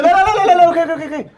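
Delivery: very high, loud frantic no's; panicked high pleading. Acted. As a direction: cave in, shrieking. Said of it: "He said".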